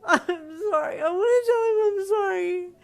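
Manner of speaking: loud, high sobbing wail; in tears. A narrator's reading. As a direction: sob out the apology. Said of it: "Kari replied in tears".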